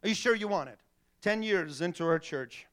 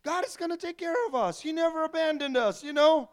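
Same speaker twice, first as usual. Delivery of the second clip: naive carefree; 10 semitones higher than usual. Retold or imitated. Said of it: "And I said".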